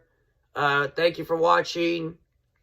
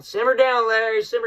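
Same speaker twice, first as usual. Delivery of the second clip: a loud, higher mock order to himself; self-scolding; 6 semitones higher, 3 dB louder than usual. Real, not acted. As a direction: tell yourself off to cool down. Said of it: "I gotta calm down".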